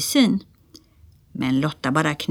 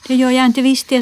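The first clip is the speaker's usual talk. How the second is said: put-on higher, louder childish retort; defiant little sister; 7 semitones higher, 5 dB louder, faster than usual. A narrator's reading. Lotta refusing her sibling's order to go home, defiant. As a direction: refuse like a stubborn small girl.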